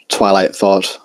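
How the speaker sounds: plain reading voice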